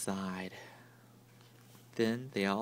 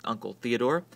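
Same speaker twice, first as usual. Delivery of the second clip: ordinary delivery, nothing marked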